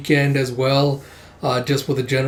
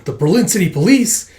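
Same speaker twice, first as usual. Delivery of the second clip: raised, louder exclamation; startled hijacker; 6 semitones higher, 5 dB louder than usual. Acted. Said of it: "Ski Cap going".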